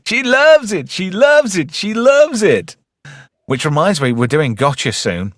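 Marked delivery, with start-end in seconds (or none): 0.1-2.8 high shouted alien voice with a laugh. Retold or imitated. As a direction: repeat it in the squeaky alien voice. Repeats the alien voice bit.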